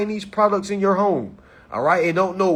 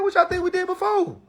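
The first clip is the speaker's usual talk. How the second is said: mock retort; 12 semitones higher than usual. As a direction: toss it back at them, teasing. Mocking the people he quotes.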